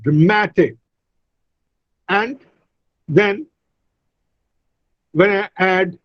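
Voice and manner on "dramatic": "dramatic" stressed on -MAT-; stress on second syllable. Shown as a demonstration. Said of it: "shifts from first to the second".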